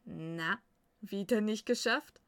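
loud, mocking brotherly tease; amused teasing. A narrator's reading. Brother teasing his beaten sister, amused loud jab. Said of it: "und musterte sie amüsiert".

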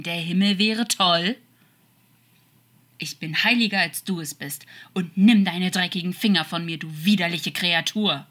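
loud, bitter accusation; scornful and forceful; angry reproach. Acted. Bitter quarrel of angel and demon.

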